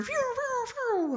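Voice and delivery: high wailing siren noise by mouth; high whooped siren sound; imitated police siren. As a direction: mimic a siren swooping down. Imitates police siren pulling her over.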